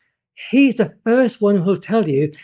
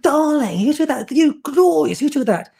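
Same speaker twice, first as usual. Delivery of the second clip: lightly voiced theatrical luvvie, higher; luvvie Kenneth Branagh; 7 semitones higher than usual. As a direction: gush it like a stage actor.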